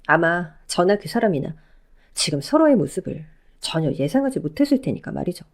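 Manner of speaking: ordinary delivery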